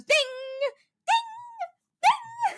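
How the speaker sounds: sing-song ding ding imitation; imitating a message ding. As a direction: chime like a phone notification. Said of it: "when you get the messages, it goes".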